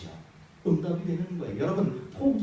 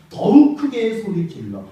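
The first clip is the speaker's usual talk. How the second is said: louder and slower, higher; louder, slow shout; 7 semitones higher, 7 dB louder than usual. Shown as a demonstration. Preacher repeating the verse, louder slow shout. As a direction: shout it out slowly.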